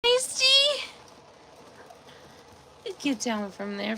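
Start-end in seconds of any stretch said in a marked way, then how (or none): 0.0-0.8 high sing-song call; calling the cat by name. Real, not acted.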